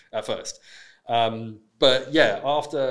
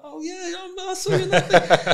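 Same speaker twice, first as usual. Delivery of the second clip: imitating an excited fan, much higher; a high, gushing fan who just spotted him; mock starstruck fan; 8 dB louder than usual. Imitating a fan who recognizes him from Love Island, high and gushing.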